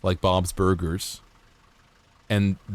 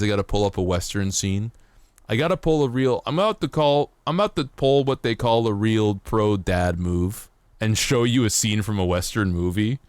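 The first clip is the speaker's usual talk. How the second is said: normal talking voice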